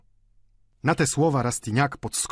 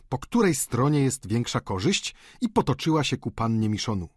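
plain reading voice

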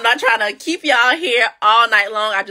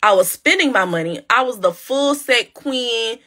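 normal talking voice

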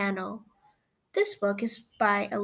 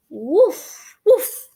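loud puppy bark; 3 semitones higher, 8 dB louder than usual. Acted. Puppy barks at found rabbit.

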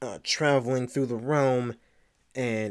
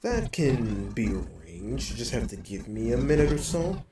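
Diablo. Acted. Diablo agrees to the player's request, in an otherworldly voice. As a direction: grant the request in an altered creature voice. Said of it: "So I speak to Diablo".

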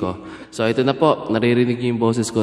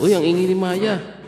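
higher-pitched reaction to a noisy dog; sudden raised-pitch interjection; real exclaimed aside; 7 semitones higher, 3 dB louder than usual. Real, not acted. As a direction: break off and exclaim about the noise. Breaks off over the noisy dog.

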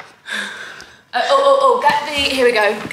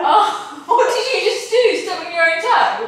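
high, laughing disbelief; 8 semitones higher than usual. Real, not acted. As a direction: laugh through the question. Laughing at friend's stubbed toe.

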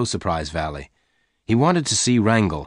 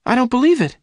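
very high, loud outburst; disbelieving cry; 6 dB louder, faster than usual. A narrator's reading. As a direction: exclaim in shrieky high disbelief. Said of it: "Jane cried".

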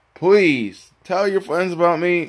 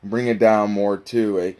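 deeper and deeper; 6 semitones lower than usual. Shown as a demonstration. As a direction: bring the voice down word by word. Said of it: "have your voice go deeper".

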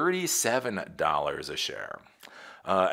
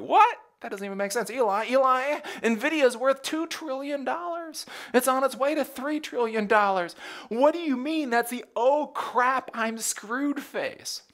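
much higher, louder, disbelieving put-on voice; incredulous viewer; 3 dB louder than usual. Viewer challenging the host over Nvidia's worth, high incredulous voice. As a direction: squeal it like a baffled fan who can't believe it. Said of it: "You're like".

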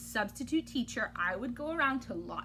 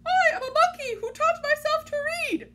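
much higher, louder proud monkey declaration; silly monkey line; 6 dB louder than usual. Acted. Reader forced to claim she's a monkey, high and proud.

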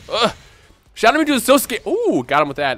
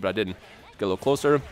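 usual speaking voice

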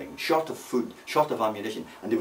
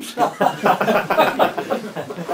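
high, loud laugh; real burst of laughter at own story; 8 semitones higher, 3 dB louder than usual. Real, not acted. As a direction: laugh heartily at the punchline. Storyteller laughs at his punchline.

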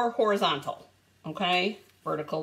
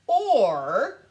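"or" louder and drawn out; big emphatic OR; 7 dB louder than usual. Real, not acted. Crafter offers viewers another option, big stressed "or". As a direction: lean hard and long on "or". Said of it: "and that is a big or".

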